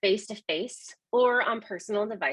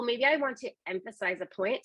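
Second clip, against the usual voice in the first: ordinary talk, nothing marked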